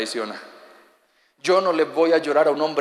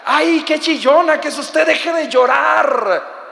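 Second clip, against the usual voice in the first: high, loud mocking scold of a crier; arrogant scolding; 9 semitones higher, 4 dB louder, slower than usual. Husband scolding his crying wife, arrogant and loud. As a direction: sneer at her for crying. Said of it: "me responde con arrogancia".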